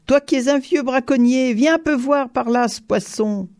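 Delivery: high, sustained call. A narrator's reading. Count summons the old poacher to the fish, calling. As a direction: keep hailing him across a distance. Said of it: "cria-t-il".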